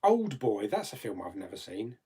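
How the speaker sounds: ordinary talk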